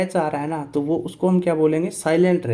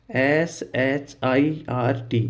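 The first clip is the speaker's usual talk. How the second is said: slow letter-by-letter spelling; much slower than usual. Shown as a demonstration. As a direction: spell it out with each letter stretched. Teacher spells out the word. (एक-एक अक्षर खींचकर धीरे बोलना)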